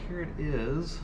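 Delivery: ordinary talk, nothing marked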